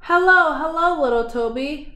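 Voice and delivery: a storybook roar of welcome; happy roar. Acted. Animals roar hello to Toby, mild happy roar. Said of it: "they all roared happily".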